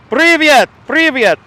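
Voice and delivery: loud, high привет in a mock Russian accent; hammed-up Russian greeting. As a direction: russischer Akzent, übertrieben. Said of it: "What accent is that".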